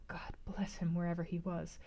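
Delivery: a private whispered blessing. A narrator's reading. Private prayer for Rochester.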